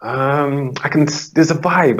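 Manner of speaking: mock client fumbling for an answer; imitating an unsure client. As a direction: pitch up and grope for the words. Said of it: "And they say".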